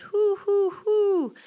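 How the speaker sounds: high, loud, eerie hoo; ghost hooting. Acted. Ghost in the closet hooting at the boy, high and eerie.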